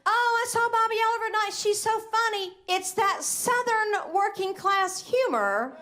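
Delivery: high gushing fanboy voice; mock fan gushing. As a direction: gush like a clueless admirer. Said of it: "he went home and tweeted".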